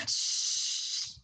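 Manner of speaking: long, soft "shh"; shushing. Retold or imitated. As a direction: imitate students hushing the class. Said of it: "kalau anak kuliah pasti bilang". (desis "sst" pelan dan panjang menirukan mahasiswa)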